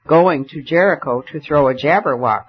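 usual speaking voice

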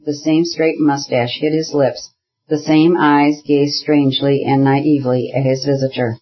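usual speaking voice

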